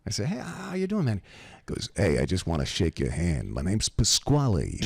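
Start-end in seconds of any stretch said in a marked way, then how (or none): 0.3-1.2 higher-pitched, mock-chirpy hello; polite friendly greeting. Timid pastor answering mobster. 2.0-4.8 lower-pitched East Coast wise guy; casual tough-guy impression; Pasquale. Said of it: "just like this. He goes".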